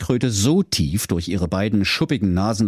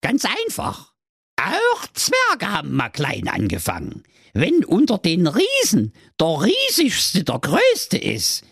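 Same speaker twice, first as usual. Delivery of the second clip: lecturing turtle; 10 semitones higher than usual. Acted. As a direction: explain it as if it were obvious. Oracle turtle explains. (hohe, geduldig belehrende Schildkrötenstimme)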